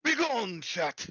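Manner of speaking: loud, high theatrical banishing; dramatic command. Acted. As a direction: bellow it like a god. Dramatic dismissal of stream chat.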